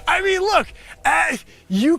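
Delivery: sudden high, loud outburst; shouting. Acted. Pitching to Barbara, a sudden loud shout. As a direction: yell it at the judge. Shouting at a Shark Tank judge.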